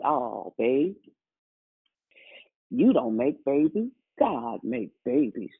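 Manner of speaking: a hint of a gentle dad consoling; dad's special way. A hint of a gentle dad telling his daughter God makes babies. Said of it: "his special way of saying things".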